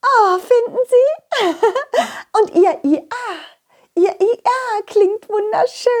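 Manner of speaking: high, dreamy lady donkey, a bit dizzy; dazed lady donkey. Acted. Lady donkey after bumping heads with the donkey, dazed and high. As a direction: she just bumped heads and shakes it off.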